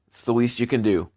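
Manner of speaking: usual speaking voice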